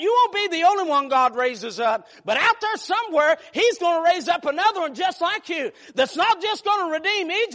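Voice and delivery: normal talking voice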